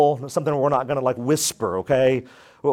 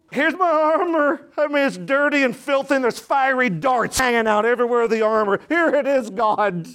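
higher, bright-eyed voice of a believer showing off dinged armor; eager offering; 7 semitones higher than usual. Pretend. Believer presenting battered armor to God, eager and high. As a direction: hand it over proudly, pitched well up.

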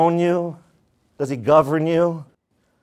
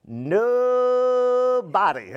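higher, very slow, first syllable held long; long, drawn-out preacher's emphasis; drawn-out answer; 8 semitones higher, much slower than usual. Real, not acted. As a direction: stretch it out like a sustained note. Answers own rhetorical question to congregation.

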